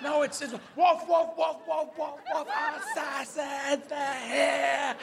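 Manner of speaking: high barking drawn into stretched words; bark, then howl the warning slowly; completely sure dog. Acted. Dog announcing assassins are here, high certain alarm. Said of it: "they're completely sure".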